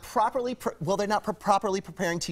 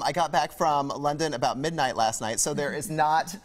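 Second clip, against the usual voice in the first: ordinary delivery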